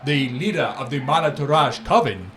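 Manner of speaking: grand merchant boast. Acted. Merchant Syrah boasting to the young friend, loud and showy. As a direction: boast like a flamboyant trader.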